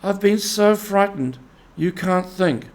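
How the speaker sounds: Mole, scared, crying out to Rat; frightened Mole crying out to Rat. A narrator's reading. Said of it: "he cried".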